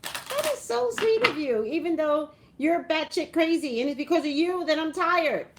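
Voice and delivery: high, bright mock-sweet voice; high put-on voice, thanking her sister sarcastically; mock sweet reply. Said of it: "I was like".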